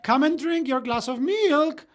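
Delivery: high, loud singsong call to a little son; mother shouting. Retold or imitated. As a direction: imitate a mum calling her boy from afar. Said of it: "I remember his mother shouting".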